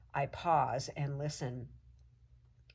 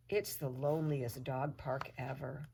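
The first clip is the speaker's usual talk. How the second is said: ordinary delivery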